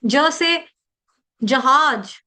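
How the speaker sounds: careful ज to open जहाज; unaspirated ज. Shown as a demonstration. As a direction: clear ज in जहाज, no puff. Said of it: "इस तरह से".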